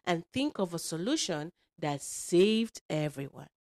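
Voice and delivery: put-on animated, emphatic storyteller for kids; lively read-aloud to young listeners; children's storytelling. A narrator's reading. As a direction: drive the story along with bold swoops. Tells a children's story with character voices.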